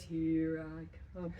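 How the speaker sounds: "Here I come" low and slow, teasing; low, slow playful threat; low fun voice. Shown as a demonstration. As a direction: drop the voice low and stalk the dog playfully. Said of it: "my voice changes, it goes down low. It's kind of a fun voice".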